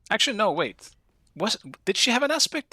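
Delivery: jumps way up in pitch, caught off guard; sudden surprise. Real, not acted. Streamer realising the aspect is new, startled high voice.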